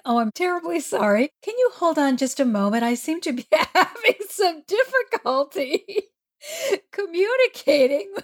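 high, hurried politeness breaking into laughter; flustered apology. Acted. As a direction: act out a rattled professional, pitch climbing. Flustered apology to client, retold.

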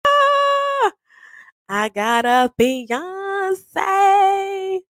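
drawn-out high delight; high gleeful squeal. Retold or imitated. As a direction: squeal it out, thrilled. Gleeful shout of engagement.

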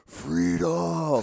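a big movie-style shout of freedom; yelled battle cry. Retold or imitated. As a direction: bellow it like a war cry. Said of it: "and he yells".

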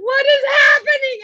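high, laughing, near-shrieking panic; mock panic. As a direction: play the horrified parent, half laughing. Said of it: "You know, like".

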